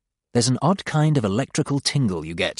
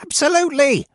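high, eager exclamation; excited; 4 dB louder than usual. Acted. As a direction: blurt it out eagerly. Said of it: "Godal bounces up and down excitedly".

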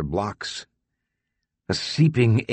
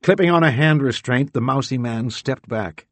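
ordinary delivery, nothing marked

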